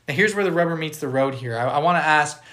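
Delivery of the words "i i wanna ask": normal talking voice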